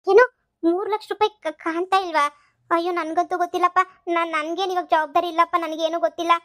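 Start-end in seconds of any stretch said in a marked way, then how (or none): 0.1-2.2 higher, louder startled outburst; shocked. Acted. Mother hearing the money is gone, shocked. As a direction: jump in shock at the news.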